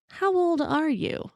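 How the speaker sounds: high, soft, slow suspicious question; wary tour manager. Retold or imitated. Tour manager quietly realising the girls might be minors, high and soft. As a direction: ask it softly, suddenly alarmed. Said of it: "And then he asks".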